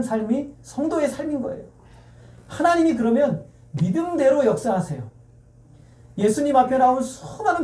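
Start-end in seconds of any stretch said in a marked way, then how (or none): none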